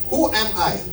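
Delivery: ordinary talk, nothing marked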